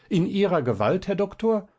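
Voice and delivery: scornful, furious retort to the doctor; angry. A narrator's reading. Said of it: "rief das Fräulein zornig". (zornig, immer höher rufend)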